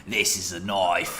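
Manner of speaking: slow, mock Australian knife line; hammed-up Australian bravado, drawn out; Crocodile Dundee Australian. To friends, joking about knives, in a mock Australian accent. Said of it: "make a Australian joke".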